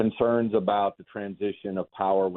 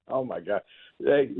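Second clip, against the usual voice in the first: ordinary talk, nothing marked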